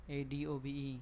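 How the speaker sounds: usual speaking voice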